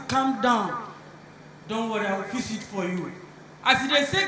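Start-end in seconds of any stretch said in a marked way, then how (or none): 0.1-3.1 calm reassurance. Acted. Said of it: "The rich man say".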